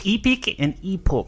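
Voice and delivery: New Zealand vowels, "Epic" as eepic; New Zealand accent. Retold or imitated. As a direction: say "Epic and epoch" with a Kiwi accent. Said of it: "what the difference is between saying epic".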